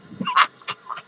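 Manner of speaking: high-pitched cackle; high, squealing burst of laughter; cackling laugh. Acted. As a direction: cackle high at your own joke. Laughs after teasing remark.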